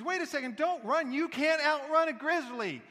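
high, loud shout after a runner; alarmed calling out. Retold or imitated. Guy warns his fleeing friend about a bear, urgent shout. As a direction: call out urgently, stretching the final warning.

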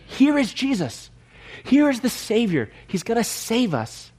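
acted crowd acclaim. Preacher voices crowd welcoming Jesus, excited acclaim.

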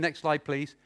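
ordinary talk

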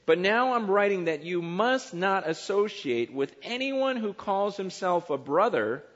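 loud, emphatic pulpit reading of scripture. Acted. Pastor reading First Corinthians aloud to his congregation, booming and emphatic. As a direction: proclaim the verses to the whole room.

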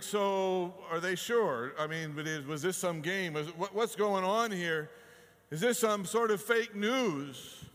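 incredulous listener voicing doubts; loud doubting questions. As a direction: fire off the doubts. Imagined doubter questioning miracle.

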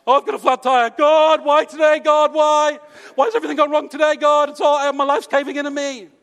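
loud, high, self-pitying wail to God; melodramatic whine. Pretend. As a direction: wail at heaven like your world is ending, loud and high. Whining to God over a flat tire.

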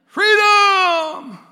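huge high roar of freedom; Braveheart battle cry. Retold or imitated. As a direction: bellow it like a warrior leading a charge.

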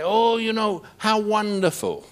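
high, gushing mock praise. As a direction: send up the admiring crowd, sugary and high. Mocking what people say.

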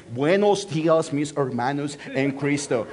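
mock American accent in Spanish. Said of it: "American accent in Spanish".

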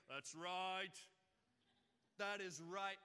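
booming amen voice. Retold or imitated. Imitating the congregant booming that's right. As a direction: call it back from the pews. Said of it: "his amen voice".

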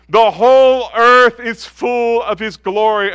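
loud, slow, ringing cry of holy; proclaiming seraph. Acted. Seraph calling to the other seraph, a loud slow proclamation. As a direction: call it across heaven like a seraph.

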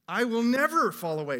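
"I will never fall away" with "never" hit high and loud; emphatic vow. Retold or imitated. Peter boasting to Jesus, loud, "never" punched hard. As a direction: swear it with full confidence. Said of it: "It's emphatic, intensive".

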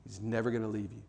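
ordinary talk

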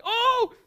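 high, loud mock yell; imitated angry outburst. Man recalling his last blow-up at her, high mock yell. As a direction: let out a high blow-up noise. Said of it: "that was the last interaction".

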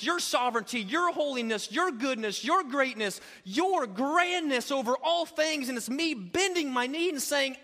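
preacher praying with full force; fervent prayer. Acted. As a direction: pour it out to God from the pulpit.

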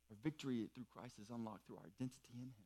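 a soft quick echo of the point; quiet quick restatement. Real, not acted. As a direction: toss the reversed line off quietly. Reversed restatement, said aside.

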